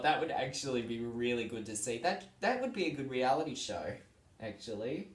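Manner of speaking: normal talking voice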